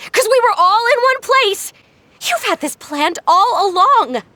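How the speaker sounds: rising angry accusation. Acted. Accusing the family of spying.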